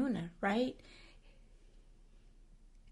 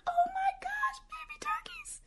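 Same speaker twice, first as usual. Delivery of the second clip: high breathless whisper of delight. Retold or imitated. Teacher spotting baby turkeys, high delighted whisper. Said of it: "And I'm like".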